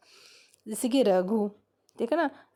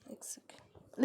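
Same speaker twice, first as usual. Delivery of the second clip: whispered aside; 14 dB quieter than usual. Real, not acted. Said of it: "sir mistake".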